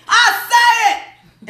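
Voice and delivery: high, loud, drawn-out put-on voice on "I'll say it"; Ida Rodriguez voice. Host speaking up for the admins in a put-on character voice, high and slow. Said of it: "In my Ida Rodriguez voice".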